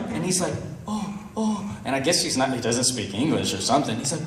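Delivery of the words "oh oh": small, high, softer voice; confused beggar. Retold or imitated. Beggar who maybe speaks no English, feigned confusion. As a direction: play dumb with soft, high little sounds.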